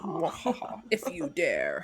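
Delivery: lightly voiced villain cackle and dare; a hint of a spooky mad scientist. Villain laugh at the outro.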